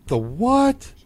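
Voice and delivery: high, puzzled question; lost by what dad just said; baffled disbelief. Retold or imitated. Baffled by dad's word.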